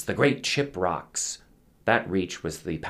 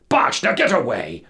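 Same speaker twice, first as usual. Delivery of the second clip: loud, high shout; angry dismissal; 5 semitones higher, 7 dB louder than usual. A narrator's reading. Captain sending crew scattering, angry shout. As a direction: drive them off with a shout.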